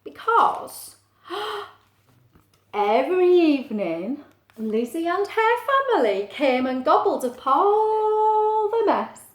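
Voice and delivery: drawn-out, dramatic storybook reveal; gasp, then build with wonder; story narration. Acted. Reads story aloud.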